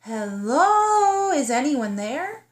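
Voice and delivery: high loud hello, then asking; searching call into the silence. Acted. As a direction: call out to find someone. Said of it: "She called out again".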